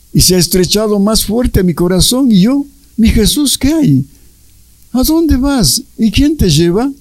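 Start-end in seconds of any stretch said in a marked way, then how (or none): 3.0-6.9 pleading questions. Retold or imitated. Luisa questions Jesus, quoted.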